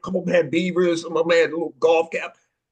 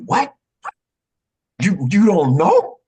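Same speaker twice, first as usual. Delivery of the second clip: shocked disbelief; 3 semitones higher, 5 dB louder than usual. Retold or imitated. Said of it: "but I was shocked".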